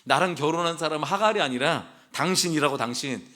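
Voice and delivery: usual speaking voice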